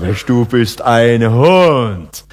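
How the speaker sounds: demonstrated compliment, savouring every word slowly; admiring. Shown as a demonstration. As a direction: stretch it out in awed recognition. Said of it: "das ist höchste Anerkennung".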